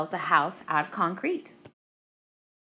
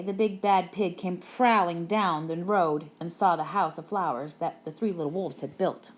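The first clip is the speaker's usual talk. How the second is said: usual speaking voice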